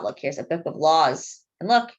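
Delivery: ordinary delivery, nothing marked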